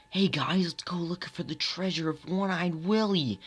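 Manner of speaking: high-pitched Goonies adventurer; excited movie character. Acted. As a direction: pitch it up like rallying friends to adventure. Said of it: "stop with the impressions".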